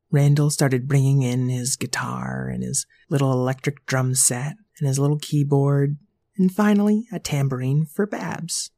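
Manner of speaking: usual speaking voice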